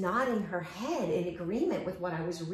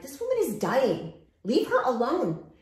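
offended nurses; 6 semitones higher, 6 dB louder than usual. Retold or imitated. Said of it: "the nurses were looking at me, like, offended".

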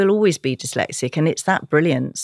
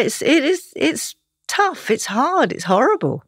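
close to tears; 9 semitones higher than usual. Real, not acted. Guest talking about struggling dyslexic kids, close to tears. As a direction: voice pitched high and strained, close to tears. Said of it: "Now you're gonna make me cry".

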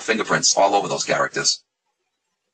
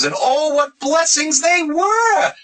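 very high, drawn-out mock wonder; sarcastic gushing; much slower than usual. Real, not acted. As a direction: gush sarcastically, stretching the words out high. Sarcastic gushing over the blessings.

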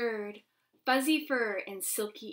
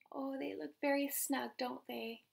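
ordinary delivery

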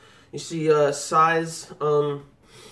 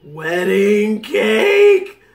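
Big Mom's hungry wail over the word cake; drawn-out monster wail; 3 semitones higher, 5 dB louder, much slower than usual. Acted. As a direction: stretch it out like a ravenous giant. Voicing Big Mom's scream.

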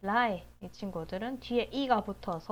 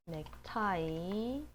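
ordinary talk

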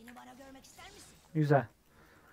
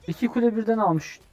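raised, higher-pitched scolding; exasperated berating; 5 semitones higher, 4 dB louder than usual. Real, not acted. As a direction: scold a useless teammate. Berates a losing teammate. (sinirle yükselen sesle azarlama)

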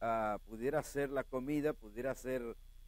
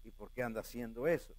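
softer, lower whiny voice of a shocked church brother; lightly voiced disapproving brother; a hint of a scandalized churchgoer; mock scandalized brother; 6 semitones lower, 6 dB quieter than usual. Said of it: "me veía ir".

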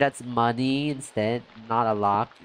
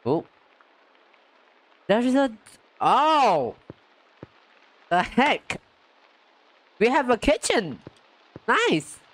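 excited discovery; 10 semitones higher than usual. Real, not acted. Finding a kitchen in game.

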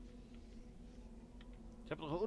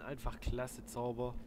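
usual speaking voice

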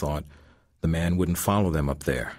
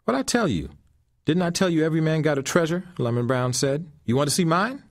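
put-on higher, louder old man's answer; old man Lemon Brown; 10 semitones higher, 3 dB louder than usual. A narrator's reading. Lemon Brown answering Greg after the fight, higher and louder. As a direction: answer up the stairs, pleased.

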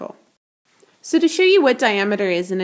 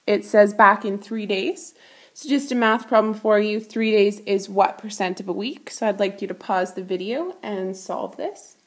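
normal talking voice

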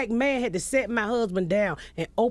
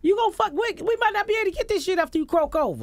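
incredulous outburst; 9 semitones higher, faster than usual. Retold or imitated. Retold outburst at husband in counseling.

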